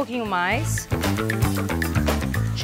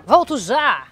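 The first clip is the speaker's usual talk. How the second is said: loud, higher sign-off; playful call-out; 4 semitones higher, 7 dB louder, slower than usual. Real, not acted. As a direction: call it out before stepping away. Calls out before a break. (chamado alto e brincalhão)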